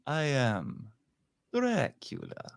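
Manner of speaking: hushed and eerie, then lift the name; Dracula impression. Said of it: "dueling Draculas here".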